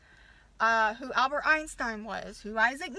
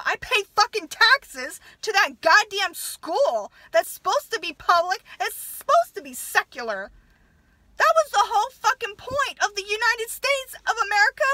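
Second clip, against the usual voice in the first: louder, higher angry outburst; angry rant; 7 semitones higher, 7 dB louder than usual. Real, not acted. Mother ranting to viewers about the public school, furious and loud. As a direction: let real anger boil over about taxes.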